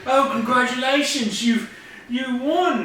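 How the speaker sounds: high-pitched New Zealand caricature; New Zealand accent. Acted. Tournament host congratulating the winners, put-on New Zealand accent. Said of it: "doing my best New Zealand accent".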